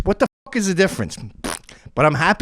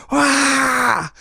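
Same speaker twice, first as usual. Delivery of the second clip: high yelp; 10 semitones higher than usual. Retold or imitated. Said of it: "and you go".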